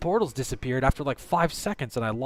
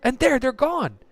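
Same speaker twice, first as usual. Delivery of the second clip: high-pitched indignant protest; outraged disbelief; 12 semitones higher, 4 dB louder than usual. Real, not acted. Gamer showing viewers the portals are gone, high outraged disbelief. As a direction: push the pitch right up in dismay.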